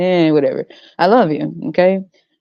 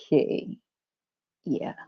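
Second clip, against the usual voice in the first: usual speaking voice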